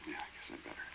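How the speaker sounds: scared whisper in the dark; hushed, nervous whisper. Acted. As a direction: breathe it, barely voiced, afraid to be heard.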